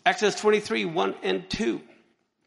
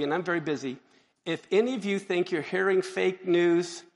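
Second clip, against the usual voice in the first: normal talking voice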